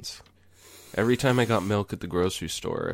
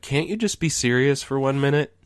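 exasperated, higher-pitched plea; raised voice, retold exasperation; pleading wife; 8 semitones higher, 4 dB louder than usual. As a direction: imitate a wife begging to be taken seriously. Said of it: "my wife was trying dearly to have a real conversation with me".

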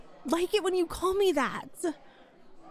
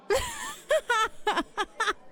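laugh; 6 semitones higher than usual. Acted. Teasing friends about spirit animals.

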